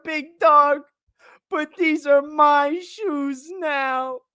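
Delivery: high, drawn-out squeal, gradually coming down; laughing to tears. Real, not acted. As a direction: squeal each phrase slowly through helpless laughter. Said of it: "I have legitimate tears in my eyes".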